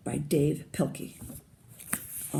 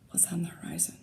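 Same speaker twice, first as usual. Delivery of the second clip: hushed, mysterious whisper; suspense whisper; 10 dB quieter, faster than usual. A narrator's reading. Reading to kids, whispering a hint of what comes. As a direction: whisper it like a secret. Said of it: "building suspense, foreshadowing".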